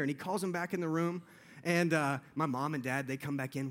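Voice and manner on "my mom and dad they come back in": ordinary delivery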